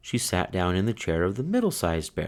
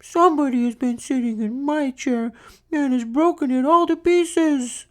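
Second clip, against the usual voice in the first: tiny wee bear crying. Acted. Little bear finding his chair broken, high crying voice. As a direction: wail it like the smallest cub.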